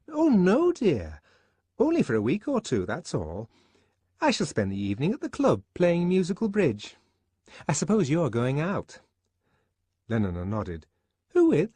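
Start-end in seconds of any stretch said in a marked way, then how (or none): 0.1-9.0 high, quick, chattering woman's voice; a fussy friend prattling on; gossipy Fanny. Acted. 11.3-11.7 high, quick, chattering woman's voice; a fussy friend prattling on; gossipy Fanny. Acted. Fanny prying.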